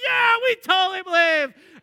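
high, loud eager crowd shout; crowd yelling. Retold or imitated. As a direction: yell it like a believing fan. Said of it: "they're like".

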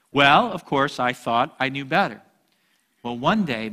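ordinary delivery

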